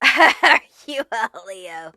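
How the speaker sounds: slow, laughing repeat of the question. Shown as a demonstration. A reader repeating her question to the viewer, slowly and laughing. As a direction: laugh through it, drawing it out.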